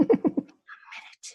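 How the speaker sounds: breathy slow whisper, caught off guard; stunned whisper. Retold or imitated. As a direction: whisper it slowly in disbelief.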